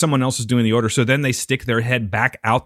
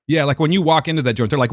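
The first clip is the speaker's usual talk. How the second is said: ordinary delivery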